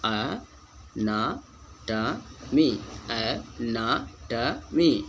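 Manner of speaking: अनाटमी in slow separate syllables, अ-ना-ट-मी; very slow model reading of अनाटमी; careful correct reading. Shown as a demonstration. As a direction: break अनाटमी into four spaced beats. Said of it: "कह के पढ़ेंगे सही से".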